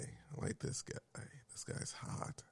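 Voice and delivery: hushed whisper of inner thoughts. Retold or imitated. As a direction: whisper it like a secret. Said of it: "Are we whispering?".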